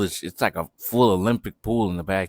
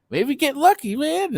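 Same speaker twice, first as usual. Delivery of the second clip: excited squeak. Retold or imitated. Teen hopeful about girl.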